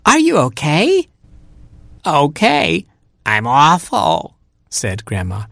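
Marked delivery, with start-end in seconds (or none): 0.1-1.1 very high, squeaky anxious question; concerned cry. A narrator's reading. Robin checks on rescued Grandma, high worried cry. 2.1-4.4 high, slow indignant granny; complaining Grandma. Acted. Rescued Grandma answers Robin, high complaining voice.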